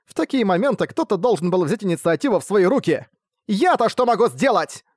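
fierce schoolgirl lecturing class. Acted. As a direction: rail at the class like an outraged class rep. Nadeka berates the class.

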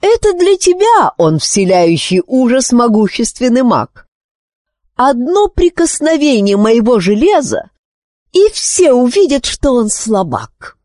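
high-pitched scornful taunt; angry, disdainful queen; contemptuous anger. A narrator's reading. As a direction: sneer at the child's hero. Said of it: "презрительно перебила его Психора, дрожа от гнева".

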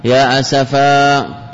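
يا حسرتا and يا أسفا drawn out slowly; slow recited example. Shown as a demonstration. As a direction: stretch the final ا of each phrase. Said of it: "وارد في فصيح الكلام".

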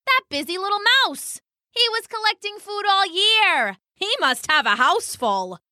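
high, eager bird voice; excited pheasant. Acted. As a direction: voice a pheasant hatching a plan, pitched high. Pheasant excited about mouse's food.

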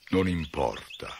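slow, calm and unbothered giant; gentle giant shrugging it off; slow easygoing giant voice; peaceful giant. A narrator's reading. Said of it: "disse il gigante pacificamente".

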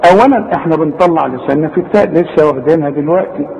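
ordinary talk, nothing marked

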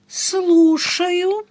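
high, drawn-out, lilting phone greeting; velvety singsong. A narrator's reading. Woman answering an expected client's call, velvety singsong. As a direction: sing it out in a velvet voice. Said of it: "пропела я бархатным голосом".

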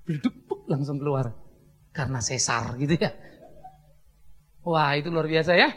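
ordinary delivery

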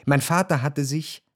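ordinary talk, nothing marked

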